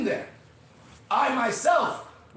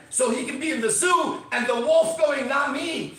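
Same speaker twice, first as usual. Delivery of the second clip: fired-up shout; 7 semitones higher, 5 dB louder than usual. Real, not acted. Wolf refusing the zoo.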